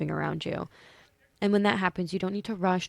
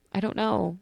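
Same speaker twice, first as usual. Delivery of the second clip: sleepy; 5 semitones higher, 4 dB louder than usual. Real, not acted. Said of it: "I'm still sleepy".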